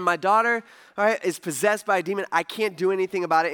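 ordinary talk, nothing marked

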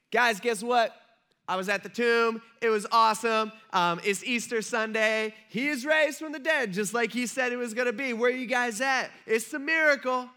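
loud, high-pitched burst of great news; excited announcement. Pretend. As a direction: gush the amazing news to your friends. Acts out joyful announcement she didn't make.

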